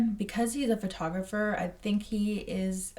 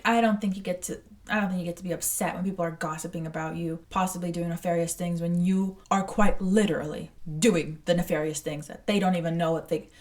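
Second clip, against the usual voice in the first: ordinary talk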